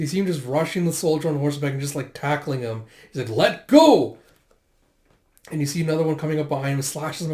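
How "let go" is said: high, loud yell; fierce shout. Acted. Zeno tackles a mounted soldier, fierce shout. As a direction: shout it mid-struggle.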